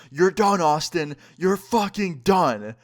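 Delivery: yelling it in capitals; retold threat shouted out; all caps yell. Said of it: "he responds in all capitals".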